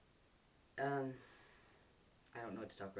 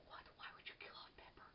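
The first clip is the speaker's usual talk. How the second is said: whispered viewers' outrage; mock shocked whisper; 12 dB quieter than usual. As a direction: breathe it like a scandalized fan.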